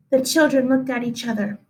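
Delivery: usual speaking voice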